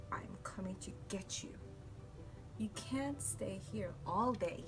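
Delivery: plain reading voice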